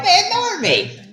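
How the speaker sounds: very high, squeaky put-on voice; mock hurt king; hammed-up high whimper; falsetto king whine.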